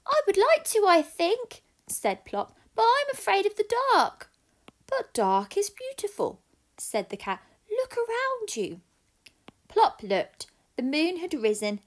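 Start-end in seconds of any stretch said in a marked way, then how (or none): 0.1-4.3 very high little owl voice; timid owlet, tempted but scared; baby owl. Acted. Plop the owl speaks. 4.9-6.4 higher, coaxing cat voice; cat persuading the owlet. Acted. The cat speaks. 7.7-8.8 much higher cat voice; cat inviting the owlet to look. Acted. The cat speaks.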